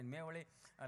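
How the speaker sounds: ordinary delivery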